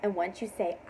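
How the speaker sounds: ordinary talk, nothing marked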